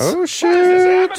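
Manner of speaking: high, drawn-out exclamation; big high-pitched reaction to news; shocked exclamation. Real, not acted. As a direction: let it soar in mock alarm. Mock shock at the headline.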